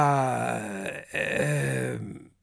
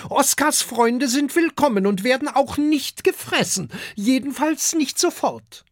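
dragon mother voice; 11 semitones higher, 3 dB louder than usual. A narrator's reading. Dragon mother reassures the guest.

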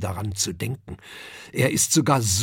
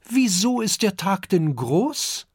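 put-on high, curious child voice; little dragon Kokosnuss asking his father, high and curious; 9 semitones higher, 4 dB louder than usual. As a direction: ask it as an eager small boy.

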